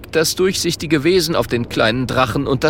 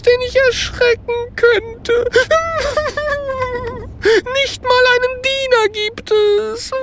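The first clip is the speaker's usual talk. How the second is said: tearful sniffling ghost. A narrator's reading. Ghost telling its sad story, sniffling and wailing. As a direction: lament with sobs between sentences. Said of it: "schnieft das Gespenst".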